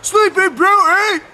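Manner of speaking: high, loud, slow shout. A narrator's reading. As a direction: cry out the name in sudden realisation. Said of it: "Tom Thumb shouts out".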